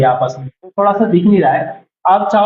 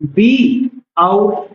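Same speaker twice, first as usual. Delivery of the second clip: spelled out slowly; much slower than usual. Shown as a demonstration. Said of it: "तो कर्ता line बनेगा".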